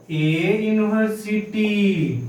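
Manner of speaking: ए यूनिवर्सिटी said slowly with a plain ए before the yoo sound; slow correct model of ए यूनिवर्सिटी; correct form said slowly. Shown as a demonstration. Teacher to class, giving the right answer slowly. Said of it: "क्या होगा?".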